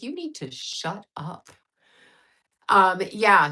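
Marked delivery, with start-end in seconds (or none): 0.0-1.5 soft, slow mock scolding; mock hushed scold. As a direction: hush them down, half joking. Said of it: "She's like".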